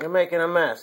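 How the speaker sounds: normal talking voice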